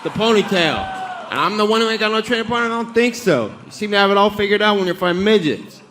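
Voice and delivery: trash talk. Real, not acted. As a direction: yell insults at a rival. Fighter yelling at rival.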